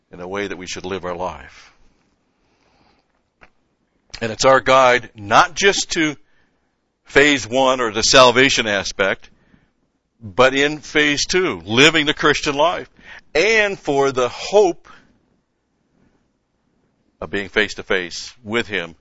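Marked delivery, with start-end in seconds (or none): 4.2-14.9 rising preacher's emphasis. Real, not acted. Emphatic point in sermon.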